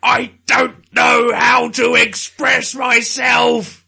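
a hint of a dim footballer; loud, slow mock footballer voice. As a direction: bellow it slowly like a dim brute. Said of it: "It's like".

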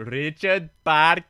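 slow, drawn-out mock Indian accent; imitated Indian accent. Imitating another podcaster's running bit, Indian accent. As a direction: stretch the name in a hammed-up Indian accent. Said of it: "all this guy's been doing for".

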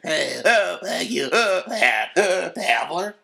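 hammed-up fit of hiccups on air; mock hiccups. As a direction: hiccup between the words. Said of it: "can't stop hiccuping for entire broadcasts".

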